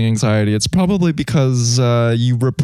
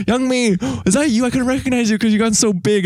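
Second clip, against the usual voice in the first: higher, faster mock auntie greeting; imitating a relative; 8 semitones higher, faster than usual. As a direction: play a gushing older relative, pitched up and quick. Relative greets her at customs.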